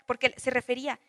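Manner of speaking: ordinary delivery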